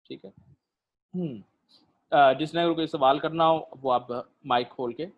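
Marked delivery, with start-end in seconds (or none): none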